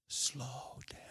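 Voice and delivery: very soft whispered tip; hushed, acting out a whispered reply; whispered answer. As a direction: slip the answer under your breath. Neighbour whispers the answer back.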